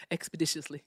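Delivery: lightly imitated rapper's diction. Shown as a demonstration. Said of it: "here's my T I impression".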